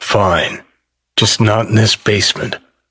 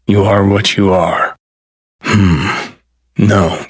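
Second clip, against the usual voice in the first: normal talking voice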